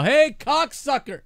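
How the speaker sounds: high, drawn-out mock yell; pretend heckling yell. Host imagines heckling Jim Cornette, mock yell. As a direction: call it out high and long like a heckler. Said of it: "I'll just yell".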